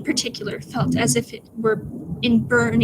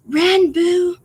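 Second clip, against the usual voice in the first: put-on loud, high British-accented call; Tubbo, urgent; 7 semitones higher, 7 dB louder than usual. Tubbo calls to Ranboo, louder and more urgent. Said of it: "Tubbo's voice was louder and more urgent".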